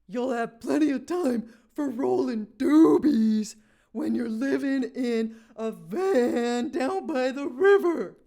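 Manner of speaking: loud, slow, bellowing rant; slow shouted Farley tirade; Chris Farley motivational speaker. Retold or imitated. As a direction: roar it like a manic motivational speaker. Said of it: "That was my Chris Farley impression".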